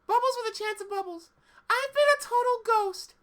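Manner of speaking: squeaky high cartoon girl; high girly cartoon voice. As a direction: pitch way up, bright and bouncy. High character voice again.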